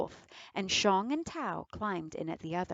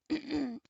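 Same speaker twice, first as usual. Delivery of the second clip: raspy throat clear; 7 semitones higher, 4 dB quieter than usual. Acted. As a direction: fake cough to clear throat. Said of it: "caught a cold".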